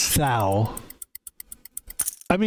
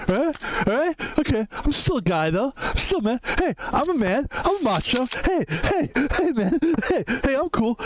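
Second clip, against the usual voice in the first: much higher, hammed-up tough-guy bro voice; mock macho guy; 8 semitones higher than usual. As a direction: parody a macho dude talking NBA, way up high. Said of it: "making fun of myself".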